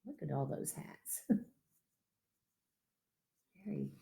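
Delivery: unvoiced whisper; amused whispered aside. Real, not acted. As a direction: whisper it over the picture. Aside showing the picture.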